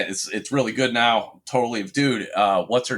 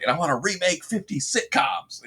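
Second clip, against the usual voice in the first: higher-pitched imitation of someone pitching; mock eager showrunner; 6 semitones higher than usual. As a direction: mimic someone announcing a pet project. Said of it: "somebody was just like".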